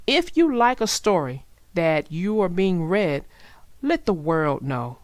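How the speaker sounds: ordinary delivery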